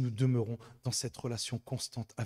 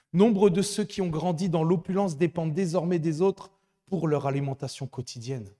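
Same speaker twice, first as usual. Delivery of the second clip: raised, urgent preaching voice; preaching at full force; 4 semitones higher, 8 dB louder than usual. Acted. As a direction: exhort the congregation with fervour. Preacher exhorting congregation.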